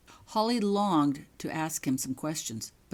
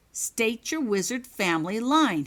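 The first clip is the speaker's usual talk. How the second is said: loud stern order; commanding; 6 semitones higher, 7 dB louder than usual. Acted. Prefect interrogating Holly, loud command. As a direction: press the demand hard. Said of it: "he commanded".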